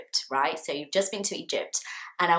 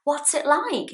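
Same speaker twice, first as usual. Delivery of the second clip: high question; 8 semitones higher than usual. Shown as a demonstration. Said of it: "'Cause I'm talking about a place".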